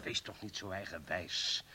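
soft, low, slow reproach; quiet rebuke. Acted. Colleague quietly and slowly rebukes the stubborn chief. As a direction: hold back your irritation and say it low.

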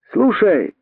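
high-pitched call for attention; voiced shout without full volume. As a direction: call up to someone out of reach. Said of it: "крикнул он снова мартышке".

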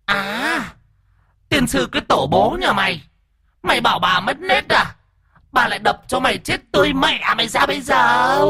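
high, screechy female ghost cursing; possessed woman's voice. A narrator's reading. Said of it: "cái giọng đàn bà vang lên nghe rõ lắm".

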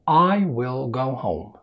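"I will go home" slow, with a clear L in will; slow speed. Shown as a demonstration. Teacher shows learners the calm speaking speed, slow. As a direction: stretch out each word calmly. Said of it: "هنبدأ بالسرعة الهادية في الكلام".

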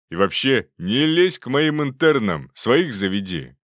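loud, emphatic telling-off; indignant warning. A narrator's reading. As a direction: warn a rival off your trainees. Acted squabble between two doctors.

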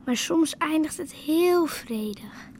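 slow dreamy whisper. Acted. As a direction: Dutch girl whispering a dream, slowly. Dreamy memory of peaceful dream.

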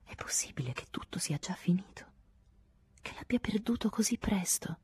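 soft unbelieving whisper, rising at the end; incredulous whisper. A narrator's reading. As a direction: ask yourself in disbelief, hushed. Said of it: "mi chiesi incredula". (sussurro incredulo)

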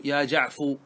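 "جعفو" ending in a clear long u; final damma. Shown as a demonstration. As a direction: close "جعفو" on an oo sound, no r. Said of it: "لا ينتظر النطق بالراء".

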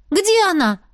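high, urgent exclamation; exclaiming. A narrator's reading. Narrator asking the cat after Stacy, exclaiming. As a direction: exclaim it urgently, pitch jumping up. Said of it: "воскликнула я".

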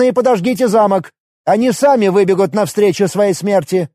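high commanding bird general. Acted. General gives orders.

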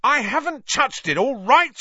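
high, loud angry shout; furious, indignant retort; roared back. A narrator's reading. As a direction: yell your innocence back. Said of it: "Ron roared back".

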